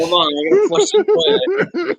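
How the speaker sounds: laughing high through the words. Pretend. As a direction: squeal it out between laughs. Laughing joke about flushing.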